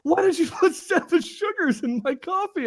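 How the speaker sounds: high, loud disbelieving yell; outraged yell. Retold or imitated. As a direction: yell it like he can't believe it. Boss outraged about sugars.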